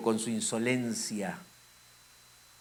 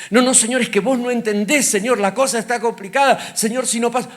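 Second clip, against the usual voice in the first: high, loud, frantic protest to God; pleading believer; 12 semitones higher, 12 dB louder, faster than usual. Acted. Worried believer arguing with God about his family, high and pleading. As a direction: plead desperately, voice pushed high.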